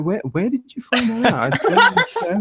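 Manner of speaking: high-pitched, laughing disbelief; incredulous retold question. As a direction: act out baffled alarm through a laugh. Retelling startled reply, amid laughter.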